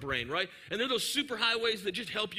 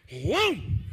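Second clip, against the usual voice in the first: jerk-stop whoosh; 5 dB louder than usual. Retold or imitated. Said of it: "and I went".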